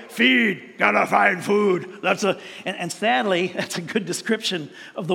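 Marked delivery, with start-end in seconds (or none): 0.1-2.0 a hint of a slow zombie groan, mostly his own voice. Preacher voicing a hungry zombie, lightly slowed groan. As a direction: lightly growl it like a zombie after food. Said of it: "their whole purpose in life is to feed".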